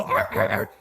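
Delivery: low, rough repeated dog noise; imitated dog groan. Storyteller imitating the dog neighbors hear, groaning. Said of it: "they hear the dog go".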